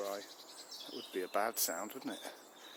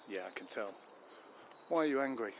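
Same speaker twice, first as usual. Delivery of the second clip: ordinary talk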